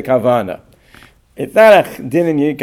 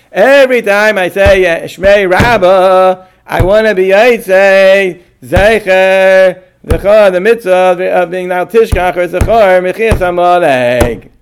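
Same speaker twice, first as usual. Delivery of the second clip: louder, higher solemn pronouncement, words drawn out; formal declaration; 4 semitones higher, 4 dB louder, slower than usual. Shown as a demonstration. Rabbi demonstrating his once-for-all declaration, loud and solemn. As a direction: proclaim it like a binding vow. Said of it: "So I'm gonna say it like this".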